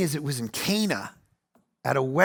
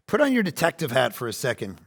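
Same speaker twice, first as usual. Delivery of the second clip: normal talking voice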